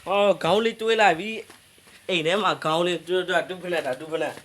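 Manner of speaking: loud, high, thrilled yelling; real triumph when the hidden one turns up; excited shout. Real, not acted. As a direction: shout out the big find. Finds the target, shouting excitedly.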